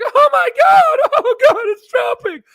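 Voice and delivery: hammed-up retail investor wailing over worst investment; mocking panicked investor. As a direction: whine like a small investor watching the stock drop.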